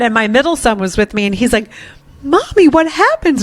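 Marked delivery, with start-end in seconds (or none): 2.2-3.4 high, lightly voiced small child asking; a hint of a worried little son; little boy voice. Mother quoting her young son during the tantrum, high child voice. Middle son asks mommy.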